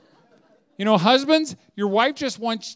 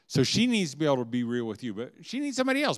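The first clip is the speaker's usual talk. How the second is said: ordinary delivery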